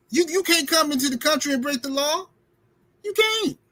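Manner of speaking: high mocking taunt at Trump voters. Real, not acted. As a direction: needle them as hard as you can.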